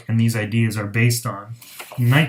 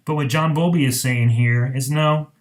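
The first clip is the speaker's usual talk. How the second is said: ordinary talk, nothing marked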